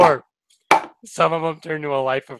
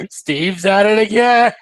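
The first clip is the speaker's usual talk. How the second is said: higher, louder, slow cry of mock despair; mock exasperated callout; 8 semitones higher, 6 dB louder than usual. Joking about a Changeling bombing a bug light, mock exasperated callout. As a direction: call it out like a sitcom catchphrase.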